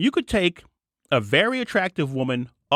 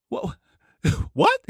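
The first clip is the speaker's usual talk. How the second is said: squeaky laughing what; high incredulous laughing what; laughing disbelief. Real, not acted. Incredulous reaction to quoted claim.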